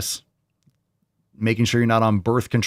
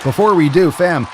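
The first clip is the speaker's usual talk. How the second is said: hyped plug; 7 semitones higher, 6 dB louder than usual. Acted.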